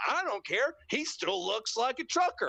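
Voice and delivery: put-on high, dismissive old-announcer voice; mocking J R. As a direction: imitate the clueless commentator. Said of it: "just like".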